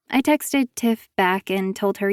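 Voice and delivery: plain reading voice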